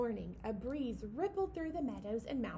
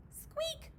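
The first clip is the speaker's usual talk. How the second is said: high squeak; 3 dB quieter than usual. Acted. Mouse squeak.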